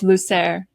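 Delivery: Lucer said as loo-ser, the American way; American way of saying Lucer. Shown as a demonstration. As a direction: say Lucer the plain American way, not French. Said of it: "the American way that I like to say it is".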